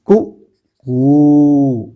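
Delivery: कु short, कू long with rounded lips; कू held with rounded lips; short and long u demo. Shown as a demonstration. Teacher contrasts the vowels for pupils, short then long. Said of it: "ओष्ठ".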